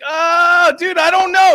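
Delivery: very high, loud wail; panicked reply. Retold or imitated. Recruit answering the screaming drill instructor, acted panicked reply. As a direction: blurt it in mock panic.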